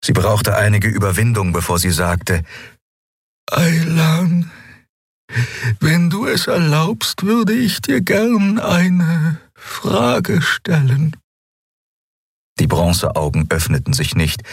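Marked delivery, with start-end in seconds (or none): 3.5-11.1 much higher, slow awestruck voice; high, reverent and slow; awed young healer. A narrator's reading. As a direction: speak as if meeting a legend. Xea asks permission timidly.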